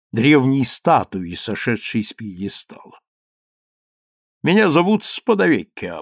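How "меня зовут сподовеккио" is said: resonant, ceremonious greeting; sonorous solemn voice. Acted. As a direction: announce it grandly, like a statue speaking. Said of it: "проговорил он звучным, торжественным голосом".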